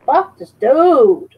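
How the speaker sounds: slow hammed-up annoyance; mock exasperation. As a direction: drag it out, fed up. Repeat slowed and louder.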